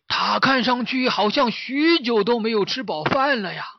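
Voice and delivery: high-pitched character voice, drawing out key words; the master character. Acted. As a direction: size up the hungry tiger aloud. 追影大师打量老虎. (大师尖着嗓子评论)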